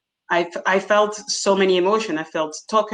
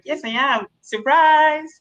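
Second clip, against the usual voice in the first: dry yes, then a higher, louder "Surprise"; sarcastic surprise; 7 semitones higher, 3 dB louder than usual. Retold or imitated. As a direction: tease them for not expecting her. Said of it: "I was like".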